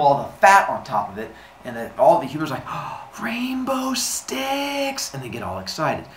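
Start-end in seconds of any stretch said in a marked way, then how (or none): 2.7-5.1 high, very slow drawn-out coo; dazzled, high-pitched marvelling; awestruck delight. Acted. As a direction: stretch it out in mock wonder. Humans see rainbow sticks.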